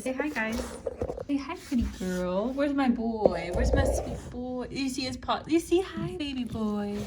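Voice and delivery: tender cooing to her pets; soft pet talk. Real, not acted. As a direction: coo at the pets gently.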